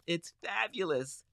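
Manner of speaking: usual speaking voice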